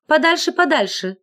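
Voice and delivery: a touch of prim alarm; prim garter. A narrator's reading.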